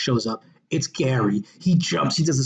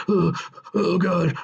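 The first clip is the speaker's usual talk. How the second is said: acted dying groan; 3 semitones higher, slower than usual. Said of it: "he's dying in their arms".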